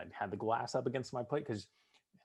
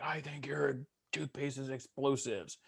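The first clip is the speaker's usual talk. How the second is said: drill instructor; 5 semitones higher, slower than usual. Retold or imitated. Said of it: "He's like".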